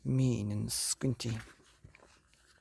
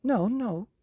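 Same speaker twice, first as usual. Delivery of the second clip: high, loud frightened plea; pleading boy; 10 semitones higher, 6 dB louder than usual. Acted. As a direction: beg like a scared boy. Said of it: "said Herb".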